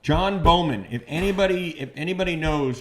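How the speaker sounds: loud, high burst of recognition; excited recall. Real, not acted. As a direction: blurt the name out like you just got it. Finally remembers the comic's name.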